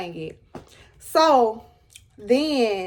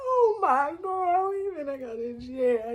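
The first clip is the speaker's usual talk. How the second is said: crying; 3 semitones higher, 6 dB quieter than usual. Retold or imitated. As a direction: whimper it as if tears took over. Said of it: "I started crying".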